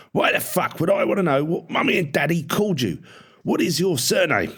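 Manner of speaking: high-pitched angry yelling; irate parade-ground tirade; furious drill sergeant. A narrator's reading. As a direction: scream it right into the recruit's face. Sergeant screaming in cadet's face.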